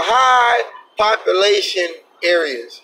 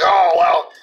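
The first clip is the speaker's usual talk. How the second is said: mocking the cops; 2 semitones lower than usual. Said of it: "And they were like".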